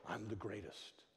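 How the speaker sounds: quiet, low boast; understated, hushed self-confidence; self-confident mantra. Acted. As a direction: say the boast softly, almost to yourself. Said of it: "what was Muhammad Ali's little mantra".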